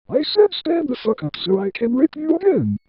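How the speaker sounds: high-pitched order at moderate volume; mild narrated shout. A narrator's reading. Old woman ordering the crying boy, mildly shouting. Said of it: "The human shouted".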